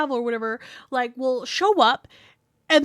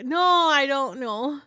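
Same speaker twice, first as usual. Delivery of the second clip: usual speaking voice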